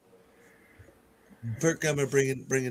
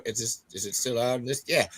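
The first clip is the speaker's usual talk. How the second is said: normal talking voice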